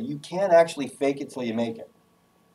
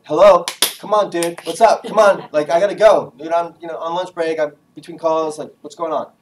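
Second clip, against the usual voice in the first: loud, higher exasperated urging; impatient prodding; 5 semitones higher, 8 dB louder than usual. Acted. Caller on lunch break to lifeless friend, loud impatience. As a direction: push a sluggish friend to wake up.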